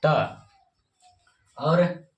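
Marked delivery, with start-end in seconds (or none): 0.1-0.4 त said alone after a pause, dental ta; letter sound ta. Shown as a demonstration. Teacher pausing, then modelling the letter त. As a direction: give त on its own, clearly. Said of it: "इसका pronunciation होता है".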